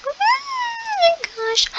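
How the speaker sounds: very high, drawn-out squeal; excited squeal. Real, not acted. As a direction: squeal it slowly, overwhelmed. Thrilled at grabbing a sprout.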